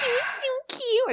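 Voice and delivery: put-on very high, tiny old man voice; squeaky old man. Mimicking the old farmer gushing, squeaky and high. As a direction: pipe it up like a sweet little farmer.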